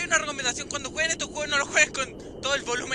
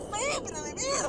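scared squeal; 13 semitones higher than usual. Real, not acted. Chillido de susto.

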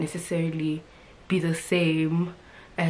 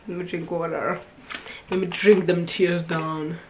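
ordinary talk

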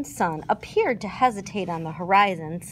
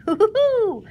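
high, loud whooping hoo-hoo-hoo; Tigger hoo-hoo laugh; 12 semitones higher, 6 dB louder than usual. A narrator's reading. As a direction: whoop it like a bouncing tiger.

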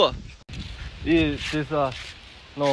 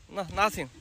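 "nothing" said much softer, falling; soft coaxing; 3 semitones higher, 11 dB quieter than usual. Real, not acted. Shows kids hands are empty again. (轻声哄着说“nothing”，语调下降)

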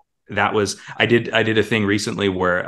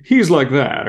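quick character voice; 6 semitones higher, 5 dB louder, slower than usual. Shown as a demonstration. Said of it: "the voice that comes out".

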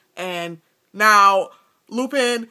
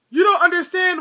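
whining; 8 semitones higher, 5 dB louder than usual. A narrator's reading. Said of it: "whined Pettigrew".

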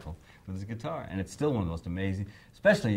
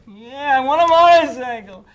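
put-on much higher and louder, insisting like a child; whining kid; 14 dB louder, slower than usual. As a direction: demand it like a stubborn toddler. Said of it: "I felt like a four-year-old".

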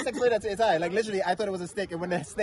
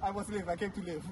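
laughing; 10 dB quieter than usual. Real, not acted. Laughing about the snake.

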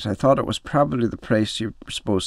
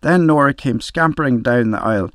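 ordinary delivery

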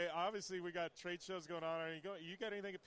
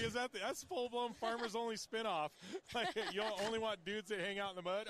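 mock outrage; 5 semitones higher, 5 dB louder than usual. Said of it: "I'm like".

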